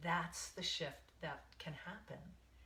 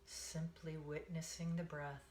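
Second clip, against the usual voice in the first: guided meditation. Acted. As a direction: ease them into the breath, one count at a time.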